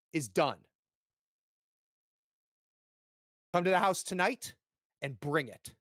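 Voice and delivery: father ordering his son home, angrily; stern anger. Acted. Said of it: "Curtis, and goes".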